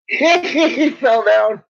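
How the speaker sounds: slow words squeezed through loud high laughter; laughing. Real, not acted. Owner watching her dog tumble, laughing hard.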